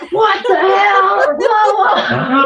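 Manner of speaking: high, stretched mock outburst; mock scream out. Woman voices the outburst her husband dreaded, mock screaming. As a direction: imitate an outburst through laughter. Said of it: "you don't, like, scream out".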